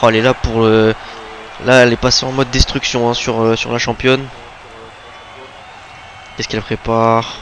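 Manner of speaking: lively live play-by-play call; wrestling commentator. Acted. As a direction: narrate the match as it happens.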